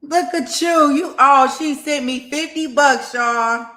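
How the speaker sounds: high, loud and delighted; thrilled. Real, not acted. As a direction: gush with excitement over the gift. Thrilled at gift of fifty.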